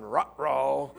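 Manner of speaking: comic cartoon-dog uh-oh; a cartoon dog sensing trouble. [name] reacting to the priest's hard question, comic cartoon-dog uh-oh. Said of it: "I'm going".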